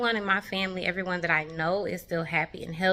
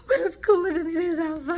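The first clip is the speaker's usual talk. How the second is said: higher, teary voice of relief; tearful relief; 8 semitones higher than usual. Real, not acted. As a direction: speak through happy tears.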